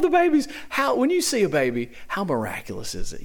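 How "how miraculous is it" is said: hushed whisper of wonder; awed whisper. Real, not acted. Preacher marveling at babies to the church, whispered. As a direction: breathe it out in amazement. Awe at a newborn baby.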